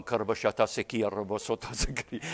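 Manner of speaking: quick, murmured tongues prayer; speaking in tongues. Retold or imitated. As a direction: rattle off tongues under your breath. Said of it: "You're going".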